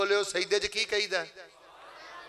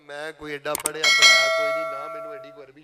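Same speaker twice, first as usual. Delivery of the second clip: humble villager; 4 semitones lower, faster than usual. Retold or imitated. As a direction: play a plain, humble villager. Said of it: "ਜਿਹੜਾ ਬੰਦਾ ਮੈਨੂੰ ਆਂਦਾ".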